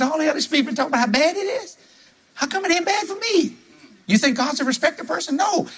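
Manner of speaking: rally the room, fast and high; fired-up preaching. Retold or imitated. Retelling his fiery preaching.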